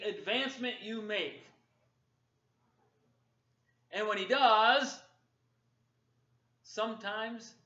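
loud, high and driving sermon; fiery loud preaching; full-force preaching. Acted. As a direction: preach it to the back row.

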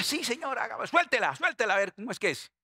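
high, excited acted plea; eager volunteer. Eager disciple telling the Lord to unleash the storm, excited acting. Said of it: "Yo también le diría".

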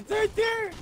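high, loud callout; urgent shout pointing out enemy; excited callout. Real, not acted. Spotting enemy, calling out.

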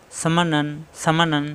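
ordinary delivery

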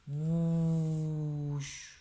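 long loud whooshing rocket noise. Pretend. Parent making the rocket launch noise for the child, loud and long. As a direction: blow out a big rocket whoosh.

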